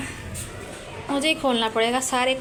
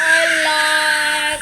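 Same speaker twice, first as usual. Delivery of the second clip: dramatic exclamation; 2 semitones higher, 4 dB louder, much slower than usual. Real, not acted. Sees child crying.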